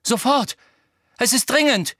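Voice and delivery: high, loud, urgent bursts; gasped urgency. A narrator's reading. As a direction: insist loudly as if out of breath. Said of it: "keuchte Harry".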